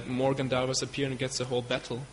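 usual speaking voice

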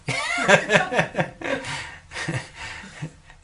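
long, high peal of laughter; high giggling laugh imitating the guru; happy laughter. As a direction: laugh on and on, happily. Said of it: "so he's laughing".

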